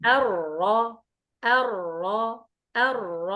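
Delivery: الر light, without pressure, slow; teacher showing a relaxed r; unstressed r. Shown as a demonstration. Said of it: "ابتداء ستريس".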